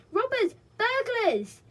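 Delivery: high, loud cry of alarm; alarmed shout. A narrator's reading. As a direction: raise the alarm. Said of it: "a loud shout".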